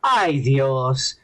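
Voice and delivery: Ay Dios sighed out low; right phrase. Shown as a demonstration. Correcting the actress's line, Ay Dios drawn out. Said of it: "she didn't say".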